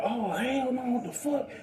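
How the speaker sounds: high, startled voice of the pump guy; surprised young man at the pump, voiced high; shocked gas attendant. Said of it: "He said".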